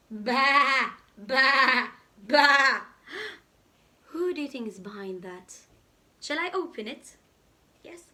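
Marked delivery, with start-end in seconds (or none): 0.1-2.8 loud bleating sheep. Acted. The sheep bleat loudly at Spot.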